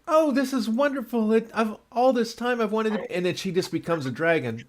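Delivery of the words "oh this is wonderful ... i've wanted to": high, bright with delight; mock overjoyed princess. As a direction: gush like a thrilled princess. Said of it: "she's like".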